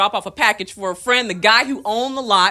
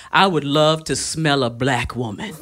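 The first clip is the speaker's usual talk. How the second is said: put-on slow, low drunk leer; drunk guy; 7 semitones lower, slower than usual. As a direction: lean in like a sloppy drunk. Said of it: "Drunk guy leans forward and he says".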